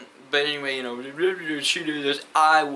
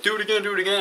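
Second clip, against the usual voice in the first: high-pitched, mock-eager goading; imitated egging on; 8 semitones higher, much faster than usual. Friends at the lunch table urging a repeat, imitated eager goading. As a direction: goad him like an excited friend.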